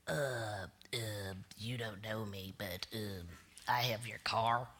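low, hesitant mumbling caller voice, full of uh and um; thief on the phone. Retold or imitated. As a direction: play the nervous car thief calling, voice pitched low. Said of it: "it goes like, something like this".

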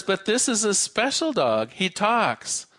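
a hint of an insistent customer; man in a joke. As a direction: pitch it up to vouch for the dog. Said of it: "The man says".

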